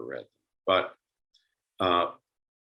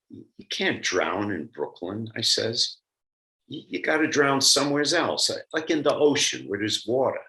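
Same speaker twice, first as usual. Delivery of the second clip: put-on Brooklyn accent; 3 semitones higher than usual. Lecturer reads a subway story aloud, in a put-on Brooklyn accent. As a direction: read it in your best amateur Brooklyn accent. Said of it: "read this in my best Brooklyn accent".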